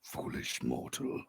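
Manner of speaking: deep, slow whispered menace; dark lord. Acted. GM voices vampire taunt.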